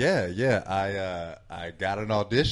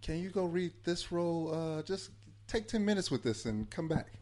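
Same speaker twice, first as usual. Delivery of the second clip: imitating casting staff; 8 semitones higher, 3 dB quieter than usual. Retells casting people's casual request.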